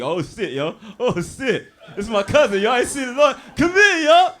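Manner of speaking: mock cousin with a lisp shouting his greeting; imitating lisping cousin. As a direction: ham up the cousin hollering across the yard. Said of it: "He got a lisp and shit".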